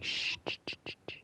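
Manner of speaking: very soft repeated hiss; imitated shushing. Hallucinated Jim hushing the player, soft imitated shushing. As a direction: hush someone with soft voiceless shushes.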